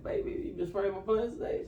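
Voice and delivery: a hint of a fussy husband checking on his plants. Husband asking his wife about his plants, lightly voiced.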